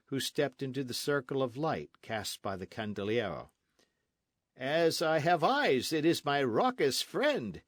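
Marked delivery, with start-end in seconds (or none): 4.6-7.6 a hint of cheerful mock welcome; glad cry of welcome. A narrator's reading. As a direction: hail an old friend gladly. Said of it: "he cried".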